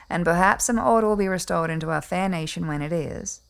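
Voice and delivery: normal talking voice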